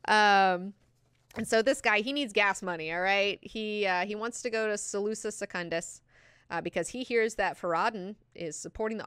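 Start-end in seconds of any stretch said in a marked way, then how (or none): none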